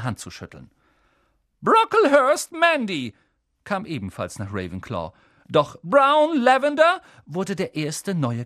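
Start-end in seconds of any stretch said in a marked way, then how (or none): 1.6-3.1 loud, high roll call. A narrator's reading. Teacher calls out names. 5.8-7.0 loud, high roll call. A narrator's reading. Teacher calls out names.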